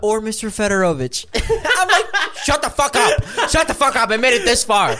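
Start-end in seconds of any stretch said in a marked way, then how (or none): 0.0-1.3 mock stewardess. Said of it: "the stewardess, and she goes". 2.4-5.0 high, loud, laughing outburst; mock shout. Passenger begging stewardess not to blow his cover, mock yelling. Retold panicked reply to stewardess.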